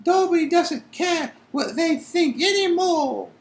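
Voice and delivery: very high squeaky, defiant; defiant elf. Acted. Dobby defying Winky in a high elf voice. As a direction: stand up to her in a squeaky elf voice. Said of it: "said Dobby defiantly".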